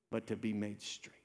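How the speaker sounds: hushed, unvoiced; whispered promise. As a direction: breathe it softly to them. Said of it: "preacher drops to whisper".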